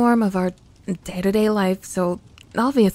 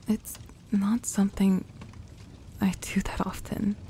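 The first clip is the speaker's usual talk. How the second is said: softer, hushed and vulnerable; soft whisper; 6 dB quieter than usual. Acted. Girl admitting to her partner she rarely lets her guard down, whispering. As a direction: let the words fall to a whisper as she opens up. Vulnerable admission while cuddling.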